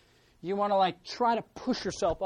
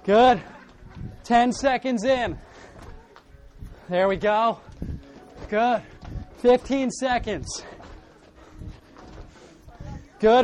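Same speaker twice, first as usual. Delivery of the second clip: loud, high workout countdown calls; aerobics instructor; 5 semitones higher, 8 dB louder, slower than usual. Real, not acted. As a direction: pump up the class, counting down.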